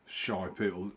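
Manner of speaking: ordinary talk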